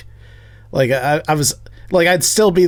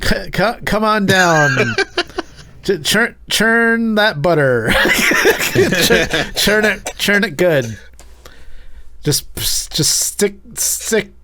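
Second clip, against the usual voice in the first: laughing; 6 semitones higher, much slower than usual. Acted. Mock ad, laughing.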